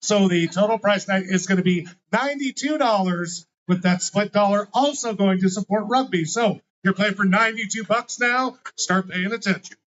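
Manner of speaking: loud call across the bar; loud announcement. Acted. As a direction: announce it to the whole room. Announcing pot to whole bar.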